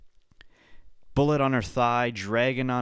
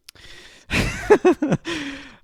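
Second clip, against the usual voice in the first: loud laugh; 8 dB louder than usual. Real, not acted. Reacting to impossible contest deadline.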